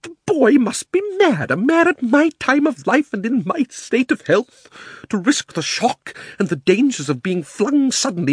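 high, loud, affronted uncle; outraged disbelief. A narrator's reading. Uncle refuses to risk himself, outraged and aghast. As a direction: splutter in high-pitched outrage, dragging key words.

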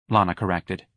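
plain reading voice

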